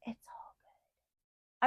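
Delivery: slow soft whisper. Real, not acted. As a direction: breathe it out as a resigned aside. Self-consoling aside.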